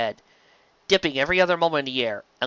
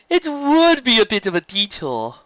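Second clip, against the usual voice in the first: higher, slow hesitant character voice; Stephen's voice; 10 semitones higher, 6 dB louder than usual. Acted.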